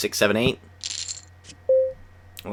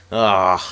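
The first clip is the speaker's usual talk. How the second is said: loud laugh and groan; 6 dB louder than usual. Real, not acted. Friend not ready.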